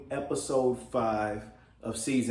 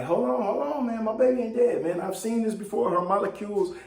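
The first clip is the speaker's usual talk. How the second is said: much higher, pleading hold on; Jefferson, frantic; 8 semitones higher than usual. Retold or imitated. Retelling Jefferson insisting his daughter lives, high and pleading. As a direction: voice the worried father refusing to give up. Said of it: "And Jefferson is like".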